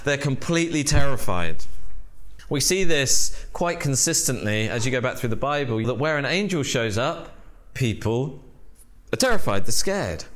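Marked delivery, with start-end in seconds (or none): none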